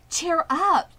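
a cheery call to a nervous friend; cheerful encouragement. A narrator's reading. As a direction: buck her up with a cheery call. Miss Arable cheering.

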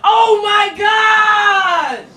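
drawn-out, high, loud cry of shock; stunned shout. Real, not acted. Watching Lily snap, a slow shocked shout. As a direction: howl it slowly in disbelief. Shocked reaction to game event.

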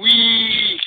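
loud, high drawn-out whee; long "whee" squeal. Acted. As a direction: squeal like a train zooming by. Twins zoom about Diesel.

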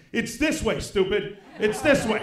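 half-laughing annoyed wife calling the way; exasperated wife. Retold or imitated. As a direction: drag him along, fed up and amused. Wife steering stoned husband.

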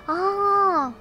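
usual speaking voice

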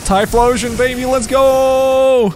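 high, drawn-out victory cry; triumphant shout. Real, not acted. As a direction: hold the GO in a long cheer. Celebrates getting Typhlosion.

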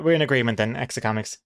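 usual speaking voice

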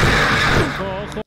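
loud, high "watch out" call; sudden warning shout. Real, not acted. Gamer shouting a warning at the game. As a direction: yell a warning at the screen. Player warning about danger.

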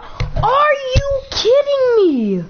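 high, slow, incredulous; stunned disbelief. Real, not acted. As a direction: drag it out in shock. Stunned by the Nike offer.

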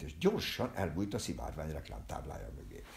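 ordinary delivery, nothing marked

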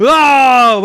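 long, loud high scream; scare scream. Pretend. Ghost determined to frighten the old man, loud scream. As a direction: scream to terrify him. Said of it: "sana bağırıyorum".

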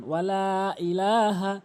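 "ওয়ালা ইলাহা" with laa held; long drawn vowels. Shown as a demonstration. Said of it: "এভাবে উচ্চারণ করবেন".

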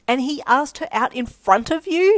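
loud, high, disbelieving question; incredulous. Acted. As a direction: ask it in utter disbelief. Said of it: "she asked incredulously".

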